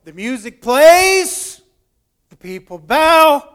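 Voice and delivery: loud, stretched "plays" and "bow"; loud dramatic build. Real, not acted. Preacher telling how the crowd bows to music, loud and drawn out. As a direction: draw out the key word at the top of your voice. Storyteller builds to the bowing moment.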